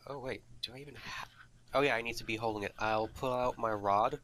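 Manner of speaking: usual speaking voice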